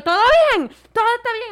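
high, loud, wary "what's your problem" check; defensive challenge. Retold or imitated. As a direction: confront the stranger who keeps following. Confronting the man following him.

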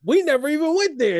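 high, loud mock outrage; indignant retort. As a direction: snap back like an offended partner. Retold couple's quarrel at quiz.

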